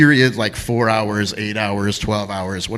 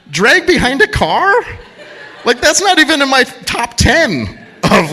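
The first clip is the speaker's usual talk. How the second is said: higher, drawn-out mock disbelief with a laugh; incredulous; 12 semitones higher, 4 dB louder than usual. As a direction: scoff at the guess, laughing. Mock outrage at the guess.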